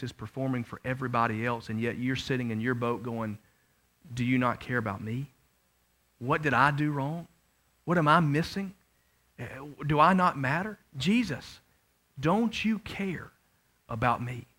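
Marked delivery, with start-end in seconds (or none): 4.1-14.5 hushed plea to Jesus; quiet hurt pleading. Retold or imitated. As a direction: ask softly, wounded. Believer's hurt questions to Jesus.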